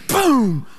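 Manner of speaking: high, falling vocal "boom"; imitated thunder boom. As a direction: burst out a sudden storm sound effect. Imitates sudden thunder in story.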